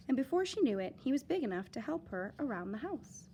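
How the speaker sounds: ordinary delivery, nothing marked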